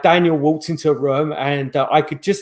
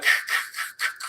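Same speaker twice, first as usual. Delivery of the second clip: breathless laugh; 8 dB quieter than usual. Real, not acted. Laughing at the box's noises.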